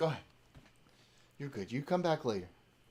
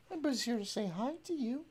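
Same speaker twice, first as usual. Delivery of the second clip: high, fast sing-song to the dog; high quick pet talk; 8 semitones higher, faster than usual. Real, not acted. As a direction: chirp at the puppy.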